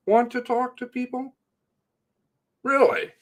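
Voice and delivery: high incredulous sarcasm; sarcastic. Real, not acted. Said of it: "My sarcasm".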